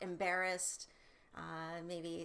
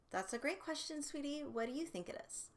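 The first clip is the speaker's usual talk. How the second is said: higher and softer, tender praising; sweet praise; 6 semitones higher, 4 dB quieter than usual. Shown as a demonstration. Parent praising a child's question, then asking back, tender. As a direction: coax the child kindly to answer. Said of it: "Then I would come back with".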